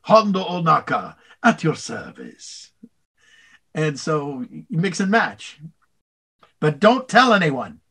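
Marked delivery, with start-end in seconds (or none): 0.0-2.7 slow, grand pirate introduction; bow and present yourself like a roguish pirate; drawn-out swashbuckling greeting; Hondo Ohnaka voice. Acted. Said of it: "and you get Hondo".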